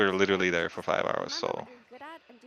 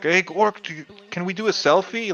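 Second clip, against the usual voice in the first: higher, keen fan asking for a selfie; eager fan voice; 8 semitones higher, 5 dB louder than usual. Retold or imitated. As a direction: play an excited fan begging. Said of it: "some guy who's like".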